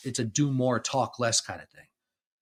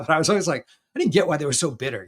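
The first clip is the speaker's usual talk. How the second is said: usual speaking voice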